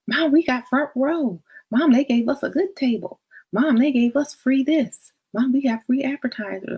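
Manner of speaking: high, excited mock reports to Mom; excited kids. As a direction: play kids proudly telling Mom the perks. Acting out kids reporting perks.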